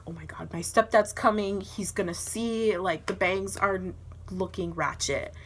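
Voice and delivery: normal talking voice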